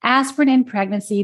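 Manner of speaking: ordinary delivery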